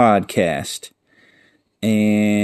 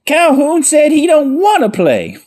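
very high, raised-voice announcement; manager calling out; 4 dB louder than usual. Retold or imitated. Calhoun's manager comes out to answer the crowd, high hollered announcement. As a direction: holler the punchline as the team manager. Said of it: "Calhoun's manager comes out and goes".

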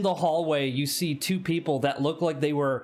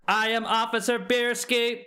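robot voice; 8 semitones higher than usual. Acted.

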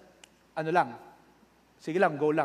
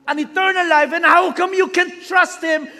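slow, much higher and louder shouted preaching; preaching at full force; 12 semitones higher, 12 dB louder, slower than usual. Acted. Pastor shouting at his online flock, full force preaching. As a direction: thunder the question at the congregation.

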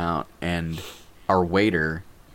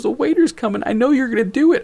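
high, louder, giggly-tense retold warning; high-pitched mock anticipation; holding back laughter; 4 dB louder, faster than usual. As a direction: mimic a friend squeaking while trying not to laugh. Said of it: "laughing and kind of hi".

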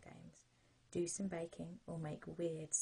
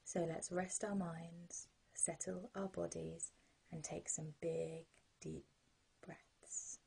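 sleep story narrator; 21 dB louder than usual. Acted.

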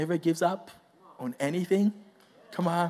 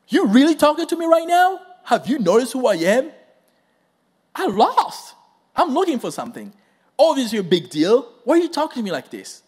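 incredulous Saul; 5 semitones higher, 4 dB louder than usual. Acted. Saul voiced in disbelief.

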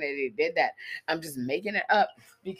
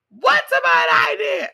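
mock yell; 12 dB louder, slower than usual. Mocking the husband yelling.

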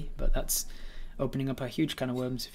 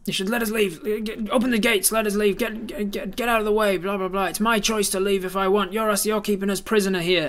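loud, high, insistent yelling; angry crowd demand; 7 semitones higher, 6 dB louder than usual. Acted. Townsfolk yelling at guards to open gates, angry.

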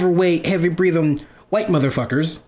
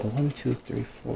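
counting under breath; 3 semitones lower, 10 dB quieter, slower than usual. Retold or imitated. Retold hesitant counting of pillows.

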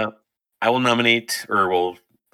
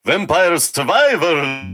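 Jamaican accent; 6 semitones higher, slower than usual. Shown as a demonstration. Friend asks for it in an accent, slow Jamaican accent. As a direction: stretch each word out like a Jamaican on request.

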